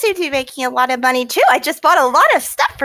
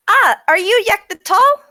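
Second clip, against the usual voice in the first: high, lilting question to a king; in-character greeting; 7 semitones higher, 3 dB louder than usual. Acted. Yuli greets His Majesty Yek, high questioning voice. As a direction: lightly put on a high greeting voice, rising at the end.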